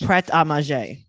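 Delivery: Prêt à manger as "pret ah mah-ZHAY"; French pronunciation. Shown as a demonstration. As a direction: repeat Prêt à manger French-style, stressed final zhay. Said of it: "she says it more French".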